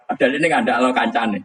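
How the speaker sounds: normal talking voice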